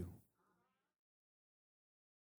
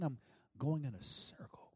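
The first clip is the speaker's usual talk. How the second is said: whispered despair; 7 dB quieter than usual. Acted. Preacher voicing a stuck listener, hushed whisper. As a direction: drop to a whisper, lost and stuck.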